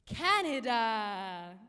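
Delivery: slow loud grand announcement; dramatic drawn-out call of Canada; announcing Canada. Acted. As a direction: boom the name out slowly.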